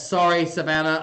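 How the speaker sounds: ordinary delivery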